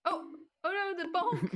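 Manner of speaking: high loud alarmed cry; mock alarm. Real, not acted. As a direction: yelp as the hit comes. Alarm at incoming bonk.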